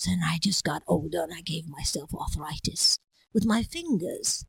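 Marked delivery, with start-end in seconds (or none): 0.1-3.0 whispery lightly aged voice; old man voice. Shown as a demonstration. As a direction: slump and speak like an old man. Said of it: "slump my shoulders".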